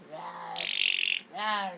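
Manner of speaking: low pretend growl; mock little beast growl; playful low rawr. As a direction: growl gently at a pet. Playful growling at pet bird.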